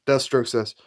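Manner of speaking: normal talking voice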